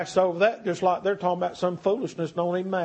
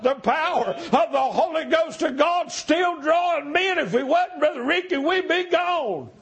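full-force preaching; 7 semitones higher than usual. Acted. Preacher pleading with the congregation to board the boat, shouting. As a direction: cry it out, begging them not to let pride stop them.

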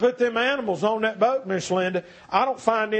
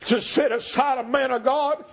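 full-force preaching; 7 semitones higher than usual. Acted. Preacher begging the congregation not to miss the boat, full-force preaching. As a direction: preach it out, begging them to come.

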